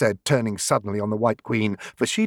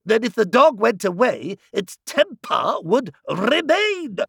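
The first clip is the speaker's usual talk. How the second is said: higher, crowing triumph as the Queen; triumphant exclaim; 9 semitones higher than usual. A narrator's reading. The Queen scoring a point over Alice, triumphant and higher. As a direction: sound like she's just won the argument. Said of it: "the Queen exclaimed triumphantly".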